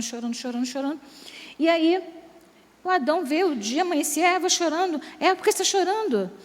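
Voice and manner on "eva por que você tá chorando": a higher, bewildered husband asking; puzzled Adam. Pretend. Adam asks his weeping wife why she cries, higher and puzzled. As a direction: ask it like a sleepy husband who can't understand the tears. Adão wakes to crying Eva.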